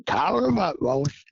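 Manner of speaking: a judge's call across the field. Retold or imitated. Judge calls out to hunters.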